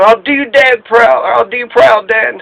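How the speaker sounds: a son promising to make his dad proud; earnest son's voice. Retold or imitated.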